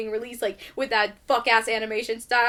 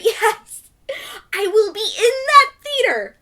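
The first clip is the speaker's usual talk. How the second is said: higher, louder, half laughing delight; excited yell; 9 semitones higher, 8 dB louder than usual. Real, not acted. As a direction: shout it out with real glee. Excited about Frozen three.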